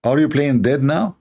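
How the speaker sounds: ordinary delivery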